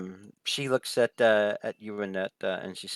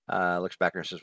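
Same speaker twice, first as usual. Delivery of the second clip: ordinary talk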